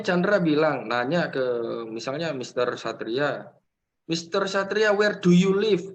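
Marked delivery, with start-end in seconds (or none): 4.1-5.9 put-on Javanese accent. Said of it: "Itu pakai aksen Jawa".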